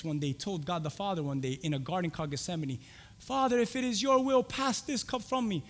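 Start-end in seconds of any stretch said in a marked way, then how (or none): 3.2-5.6 pleading prayer. Acted. Jesus to the Father in Gethsemane, pleading prayer. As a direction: plead upward, slowing at the end.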